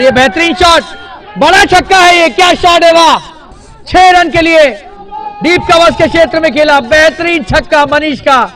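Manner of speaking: loud, high-pitched call of a six; excited. Real, not acted. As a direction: roar it out like a huge six just cleared the rope. Commentator calls a huge six.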